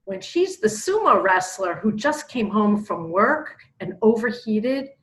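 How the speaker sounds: ordinary talk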